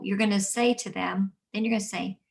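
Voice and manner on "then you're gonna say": usual speaking voice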